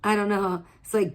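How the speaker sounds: ordinary talk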